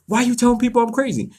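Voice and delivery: high, fast mock outrage; imitated psycho ex. Voicing angry ex in story.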